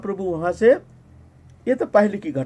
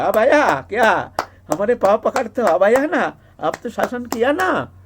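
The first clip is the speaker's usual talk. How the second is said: loud, high acted crowing; clap-happy triumph at having got the punishment he wanted; gleeful taunt; 5 semitones higher, 4 dB louder than usual. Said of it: "अब फिर हंसने लगा, हाथ ताली दिया".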